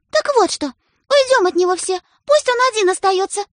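very high, loud; punishing order. A narrator's reading. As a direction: shame him by leaving. Sister rallies others away.

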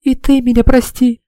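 high, loud crying plea; sobbing apology. Acted. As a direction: cry out for forgiveness. Tearful reunion, girl begs forgiveness.

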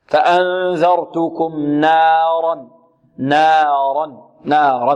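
نارا very slowly with a clean open alif; correct pure fatha. Shown as a demonstration. Teacher slowly modelling the right alif for students. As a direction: draw out نارا with no tilt at all. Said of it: "غير مشابه بشيء من الياء".

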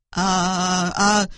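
low, hesitant "uh, uh"; confused farmer searching for an answer; flustered. Acted. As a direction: stall in puzzlement. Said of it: "Bác nông dân gãi đầu bối rối".

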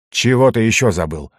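ordinary delivery

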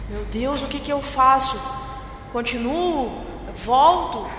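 panicking. Acted. Frightened woman recalling her attempt to flee the man, panicking. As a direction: build from dread into frantic fear.

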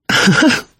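short high-pitched giggle; high little giggle. A narrator's reading. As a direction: let out a quick, high titter. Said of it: "хихикнула она".

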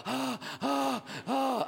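high, panting "ah" noises; mock-panicked breaths, high pitched; exaggerated gasps. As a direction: gasp as if finally off the bridge. Acting relief after the bridge.

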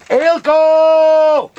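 loud high call across the yard. Acted. Villager calls out loudly to Eelco across the way. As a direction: shout his name to reach him far off.

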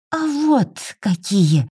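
put-on slow, high menacing child voice; sinister. A narrator's reading. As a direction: pronounce it slowly like a little villain. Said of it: "зловеще изрекало дитя". (зловеще, медленно и высоко)

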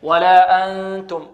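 slow chanted ولا أنتم with a held nasal noon; ghunnah. Shown as a demonstration. Tajweed teacher repeats the rule's example, slow sustained nasal hum. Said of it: "من، من غنة".

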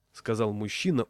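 ordinary delivery

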